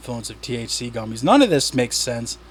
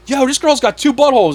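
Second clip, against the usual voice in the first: higher-pitched imitation of a commenter; lightly voiced online commenter; 11 semitones higher, 5 dB louder than usual. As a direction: read the comment up high, as an astonished stranger might. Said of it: "Someone's like".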